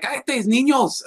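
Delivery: high, slow mock scold in Spanish; scolding mother. Imagining hushing runaway minions, high slow scold. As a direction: hush the kids like an exasperated mom. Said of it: "just being like".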